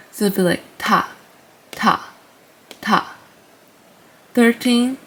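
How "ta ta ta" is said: "ta" repeated with a light puff; Korean t sound. Shown as a demonstration. Teacher drilling "ta" for learners. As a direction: tap out "ta" evenly. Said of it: "makes like a t sound".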